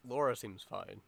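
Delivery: usual speaking voice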